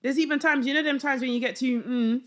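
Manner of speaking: usual speaking voice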